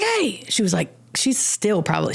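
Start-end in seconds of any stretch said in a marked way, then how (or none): 0.0-0.4 sharp call. Retold or imitated.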